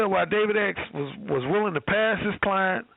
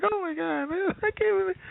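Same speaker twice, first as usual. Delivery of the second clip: imitating a flustered, blushing woman, higher; 11 semitones higher than usual. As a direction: gush like a flattered woman caught off guard. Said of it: "she starts blushing like crazy".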